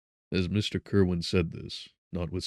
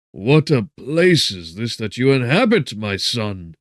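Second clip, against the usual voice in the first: mournful; 6 semitones higher, 13 dB louder than usual. A narrator's reading. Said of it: "looking mournfully".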